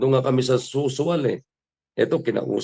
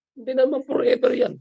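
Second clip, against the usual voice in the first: pitched way up, insisting; emphatic high retort; 9 semitones higher than usual. Acted. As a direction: push "Hindi naman forever yan" high to drive it home. Repeated point, much higher.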